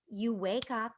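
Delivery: ordinary talk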